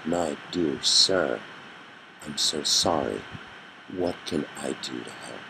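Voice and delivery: reach out kindly with a hushed voice; tender comfort. Retold or imitated. Covey comforts the grieving father.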